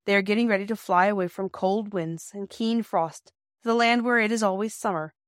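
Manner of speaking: usual speaking voice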